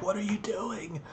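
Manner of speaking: high, hushed disbelief; a mom stunned by a naked man running past; shocked. Retold or imitated. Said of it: "my friend K Burn's mom actually saw him naked and was like".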